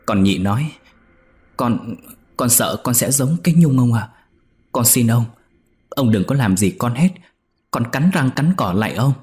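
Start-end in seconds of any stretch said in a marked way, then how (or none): 1.6-9.1 a touch higher and louder, pleading; anxious plea to her master; fearful begging. A narrator's reading. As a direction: beg him with fear in the voice. Servant girl begs master.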